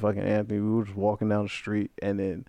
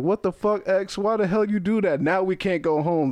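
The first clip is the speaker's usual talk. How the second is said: higher-pitched, indignant telling-off; retold angry outburst, pitched up; mad friend; 8 semitones higher than usual. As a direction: mildly imitate an angry friend demanding answers. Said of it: "they were all mad at me".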